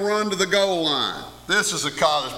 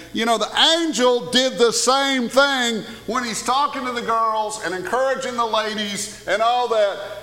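ordinary talk, nothing marked

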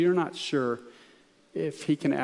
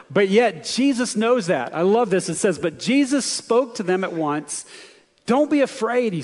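louder, higher preaching build; full preaching; 6 semitones higher, 5 dB louder than usual. Acted. Pastor to congregation, preaching the storm at full force. Preacher reads the rescue dramatically.

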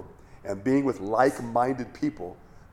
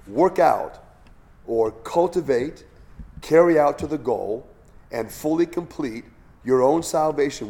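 louder, earnest reading of the verses; scripture reading; 5 dB louder than usual. Acted. Pastor reading Philippians to the class, earnest. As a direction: read it out as Scripture to the congregation. Reads Amplified verses aloud.

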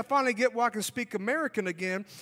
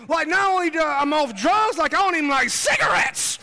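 loud, raised, emphatic amazement; amazed exclamation; 4 semitones higher, 7 dB louder than usual. Retold or imitated. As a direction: marvel out loud at the change. Exclaims his amazement at changing.

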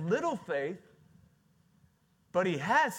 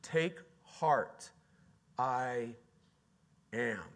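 literal translation; 3 semitones lower, much slower than usual. Shown as a demonstration. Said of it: "give you the literal translation".

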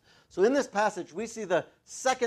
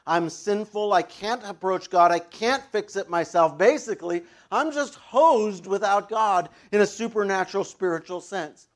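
preaching; 5 semitones higher, 7 dB louder than usual. Acted. Preaching at full force.

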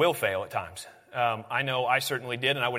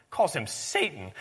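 ordinary delivery

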